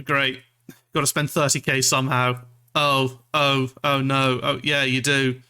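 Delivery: ordinary talk, nothing marked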